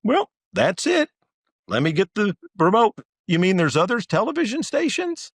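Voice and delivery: very high-pitched put-on voice; mock clueless viewer. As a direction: play a baffled viewer in squeaky mockery. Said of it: "Do you think somebody said".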